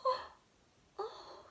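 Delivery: excited little squeal. Acted. Henry spotting a picture in the stars, squealing. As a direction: squeal it with wonder. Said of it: "he squealed".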